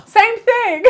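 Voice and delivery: high, loud burst of amused disbelief; gasp, then exclaim it high; amazed. Real, not acted. Amazed both children asked alike.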